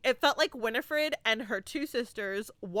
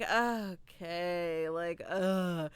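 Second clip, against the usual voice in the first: bored; 5 semitones lower, 4 dB quieter, much slower than usual. Retold or imitated. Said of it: "I was just like".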